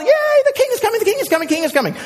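very high-pitched mock cheer; rapid-fire, high excited crowd cry. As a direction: cheer like a villager running out. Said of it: "Everyone, like, yelling and screaming".

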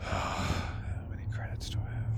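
unvoiced, slow whispered aside. Real, not acted. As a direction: hush the question so the guard won't hear.

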